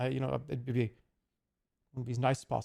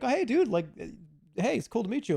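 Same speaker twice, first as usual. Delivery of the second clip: eager fan; 7 semitones higher than usual. Retold or imitated. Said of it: "be like".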